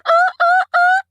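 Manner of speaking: high, loud yelping whimpers; imitated dog crying out; hurt dog yelps. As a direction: yelp like a beaten dog running off. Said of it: "the dog ran away going".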